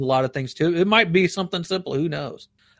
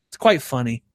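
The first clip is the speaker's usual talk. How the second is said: ordinary talk, nothing marked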